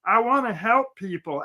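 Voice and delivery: earnest bumpkin impression; put-on simple country voice; Gomer Pyle. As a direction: play a naive, well-meaning yokel. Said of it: "sounds like Gomer Pyle".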